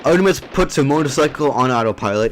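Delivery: ordinary delivery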